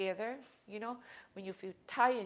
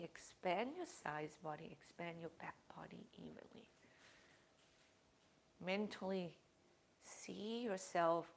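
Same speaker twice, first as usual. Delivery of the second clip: soft, hushed meditation voice with long pauses; guided meditation; 6 dB quieter than usual. Acted. Yoga teacher guiding the class through breathing, soft and hushed. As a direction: ease the class into calm breathing.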